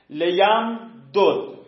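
لیم دود said slowly with the two dals separate; slow teaching read of لیم دود; slow model form. Shown as a demonstration. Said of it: "چهار نمونه میشه".

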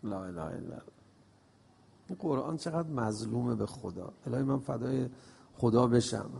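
hushed, low and soft; quiet sigh of devotion. Real, not acted. Preacher grieving softly for the neglected Quran. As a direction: drop to a near whisper of sorrow. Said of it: "sighs a prayer".

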